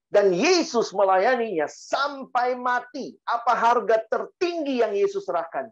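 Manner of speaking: high, drawn-out preacher's delivery; emphatic preaching. Acted. Preacher urging a younger worker to remember the sacrifice, emphatic preaching. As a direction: preach it with rising force, stretching key words.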